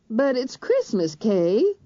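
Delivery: a hint of a pleading mother; mother's voice. A narrator's reading.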